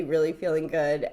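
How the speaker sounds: ordinary talk, nothing marked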